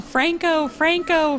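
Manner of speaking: high calls of Franco across a pool; child calling out. Retold or imitated. Little girl calling to Franco across the pool, high call. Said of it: "she was yelling".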